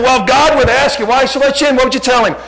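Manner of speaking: high, quick, forceful question; loud retold questioning. Preacher retelling how he questioned the young man, high and loud. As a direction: press him loudly and fast.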